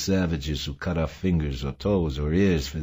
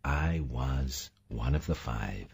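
hushed admission; 6 semitones lower, 8 dB quieter than usual. A narrator's reading. Mavis's hushed confession.

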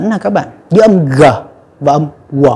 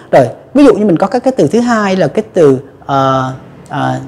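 ordinary talk, nothing marked

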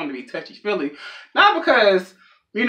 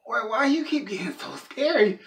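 scared questioning; 5 semitones higher, 5 dB quieter than usual. Acted. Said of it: "looking at everybody like".

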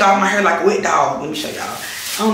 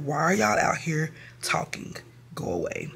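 irritated whisper; 9 dB quieter than usual. Retold or imitated. Irritated by people talking outside.

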